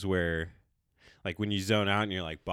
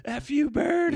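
mock curse at bird; 5 dB louder, much slower than usual.

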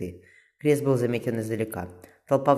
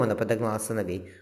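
plain reading voice

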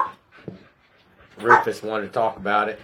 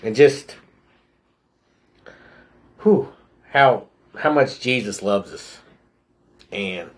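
choked-up pauses between heavy exhales; real emotion welling up, halting for breath; a little emotional. Real, not acted. Speaker moved by how much Jesus loves us, a little emotional. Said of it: "I'm getting a little emotional".

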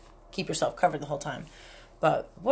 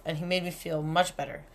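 ordinary talk, nothing marked

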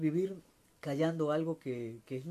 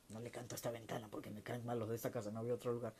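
grumpy bird voice; 4 semitones lower, 6 dB quieter, faster than usual. Pretend. Voicing a grumpy songbird.

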